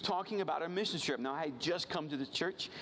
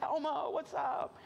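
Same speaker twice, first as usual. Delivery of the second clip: jazzed pastor greeting; 12 semitones higher, slower than usual. Retold or imitated.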